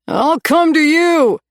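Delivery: high, loud and slow shout. A narrator's reading. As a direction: call out reassuring the lost girl. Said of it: "he shouted".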